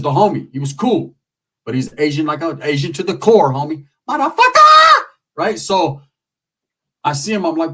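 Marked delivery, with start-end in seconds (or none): 4.1-5.0 sudden high shriek of a curse; shouted curse. Acted. Comic yelling the punchline at the crowd. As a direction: scream it out at full force.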